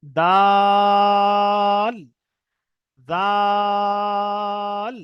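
দাল, জাল each stretched three beats; drawn dal and zal. Shown as a demonstration. Teacher repeating the pair for students. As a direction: hold each vowel long, ল at the end. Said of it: "যেমন".

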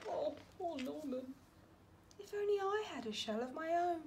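soft, sorry-for-himself wish; lonely slug by the moonlight; sad sigh. Acted. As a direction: sigh it to yourself, left out. Norman sad and alone.

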